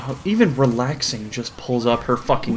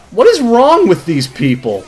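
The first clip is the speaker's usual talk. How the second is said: high, loud disbelief; loud comic exasperation; exasperated outburst; 8 semitones higher, 5 dB louder than usual. Real, not acted. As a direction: cry it out, appalled.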